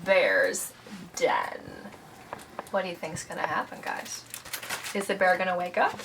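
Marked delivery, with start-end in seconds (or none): 2.7-6.0 quiet aside. Real, not acted. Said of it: "asks the listening kids".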